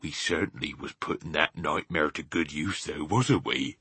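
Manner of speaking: hushed, understated satisfaction; quietly. A narrator's reading. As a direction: remark it quietly to a companion. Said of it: "he said quietly".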